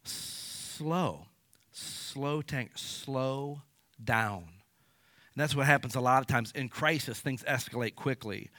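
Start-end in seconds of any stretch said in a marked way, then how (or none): 0.0-4.4 much softer, drawn-out "slow". Shown as a demonstration. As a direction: model slowing down, quietly. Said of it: "not raising your voice".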